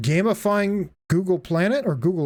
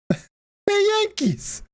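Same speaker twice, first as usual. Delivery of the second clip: mock yell at Yankees; 12 semitones higher, slower than usual. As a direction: heckle the boats from shore. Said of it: "You can, like, yell at the Yankees".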